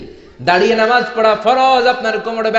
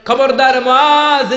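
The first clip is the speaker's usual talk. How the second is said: slow, loud, high warning; stern warning; 6 semitones higher, 4 dB louder, much slower than usual. Acted. Prophet warning Muaz about long prayers, stern slow warning. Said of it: "ধমক দিয়ে বললেন".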